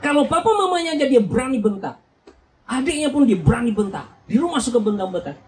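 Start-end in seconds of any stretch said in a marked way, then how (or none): none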